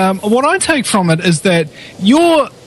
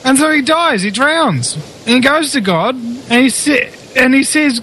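ordinary delivery

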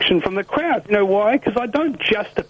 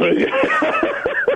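high, breathy burst of laughter; amused laugh. Real, not acted. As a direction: laugh out at the punchline. Laughs at his own story.

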